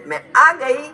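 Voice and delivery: mother's surprise arrival, high and loud; acted excited announcement.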